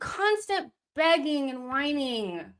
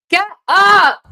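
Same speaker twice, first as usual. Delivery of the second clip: angry shout; 9 semitones higher, 16 dB louder than usual. Real, not acted. Yelling at a player on the ground.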